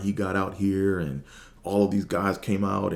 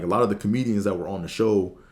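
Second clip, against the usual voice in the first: ordinary talk, nothing marked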